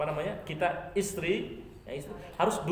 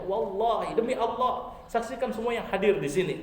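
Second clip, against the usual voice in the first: earnest oath; 6 semitones higher, 4 dB louder than usual. Acted. Mother swears before witnesses.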